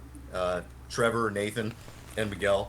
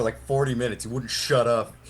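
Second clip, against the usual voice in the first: ordinary delivery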